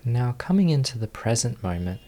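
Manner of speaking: ordinary talk, nothing marked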